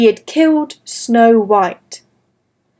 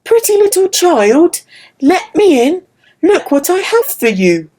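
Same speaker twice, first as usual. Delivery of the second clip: put-on higher, coaxing old woman voice; old peasant woman; 5 semitones higher than usual. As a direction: tempt the child through the door.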